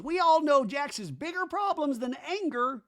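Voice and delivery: Danny impression. Danny confronting Jax about parties, high aggrieved voice. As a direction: whine it high like a hurt friend left out. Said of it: "Danny in a talking head goes".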